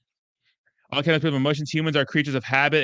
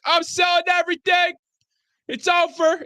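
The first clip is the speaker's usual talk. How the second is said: high shouted mock panic, much higher; mock the sellers with a high panicked shout; panicked mock wail; 14 semitones higher, 3 dB louder than usual. Streamer mocking panicked sellers with a high shout.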